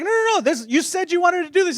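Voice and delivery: high-pitched, urgent imitation of a dad; pleading dad. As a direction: protest like an exasperated father coaxing his kid. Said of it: "He's like".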